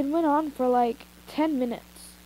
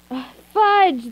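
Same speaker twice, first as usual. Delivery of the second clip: flustered slip; 6 semitones higher, 7 dB louder than usual. Real, not acted. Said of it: "that's Rush".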